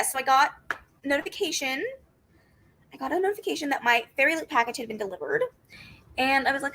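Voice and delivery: ordinary delivery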